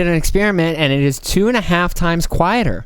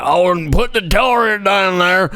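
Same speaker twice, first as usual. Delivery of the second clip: slow, lightly voiced gruff cowboy drawl; a hint of a laconic outlaw, taken slowly; Arthur Morgan impression; 4 semitones higher, slower than usual. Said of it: "Fucking Arthur Morgan up there".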